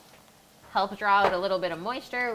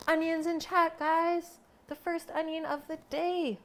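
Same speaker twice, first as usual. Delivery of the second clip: mock hype; 11 semitones higher than usual. Playful streamer-style announcement.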